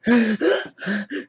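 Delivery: series of sharp audible gasps; shocked gasps. Acted. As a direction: gasp in mock horror. Acted shock after "and then.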